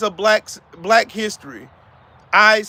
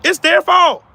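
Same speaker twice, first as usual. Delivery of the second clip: higher and louder, pointing the finger; angry declaration; 8 semitones higher, 6 dB louder, slower than usual. Real, not acted. Telling viewers who is really to blame, loudly. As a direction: slam the blame home.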